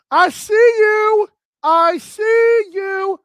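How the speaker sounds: loud, high, drawn-out approval; delighted shout-out. Real, not acted. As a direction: stretch each word long and high, hyped. Delighted at hearing show's name.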